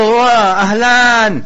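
loud greeting. Acted. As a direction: call out an exaggerated hello. Gloating neighbour hails his victim.

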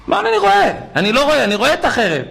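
high, forceful retort; emphatic insistence. Retold or imitated. Rabbi insisting he sees the sword, high forceful retort.

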